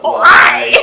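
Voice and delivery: a hammed-up croaked Why; comic croaking try; mock croak. Shown as a demonstration. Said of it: "I have to croak".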